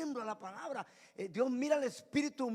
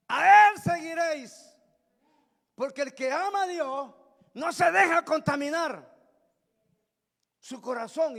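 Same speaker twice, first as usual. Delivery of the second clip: loud, raised proclamation; full-force preaching; 7 semitones higher, 13 dB louder than usual. Real, not acted. Preacher urging the congregation to follow God, loud high-pitched preaching. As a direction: cry out the command, then drive it home.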